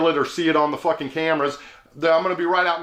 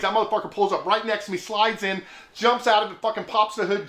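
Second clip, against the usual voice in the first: ordinary delivery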